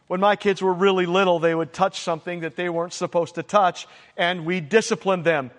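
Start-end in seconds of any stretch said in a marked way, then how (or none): none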